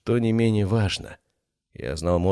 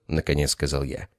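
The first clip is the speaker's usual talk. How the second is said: ordinary delivery, nothing marked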